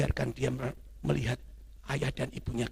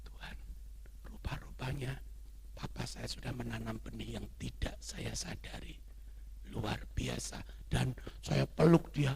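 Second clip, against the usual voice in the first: tearful; 5 dB quieter than usual. Retold or imitated. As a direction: softly imitate a moved, weeping confession. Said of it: "dia nangis".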